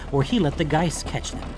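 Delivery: usual speaking voice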